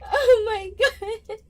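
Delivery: high, teary laughter through an apology; real tears of laughter; crying with laughter. Real, not acted. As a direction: apologize while helplessly laughing. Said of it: "I can't stop laughing".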